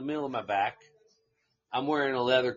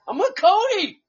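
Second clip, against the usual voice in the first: nervous protest; 9 dB louder than usual. Retold or imitated. Pleading with hostile cowboys.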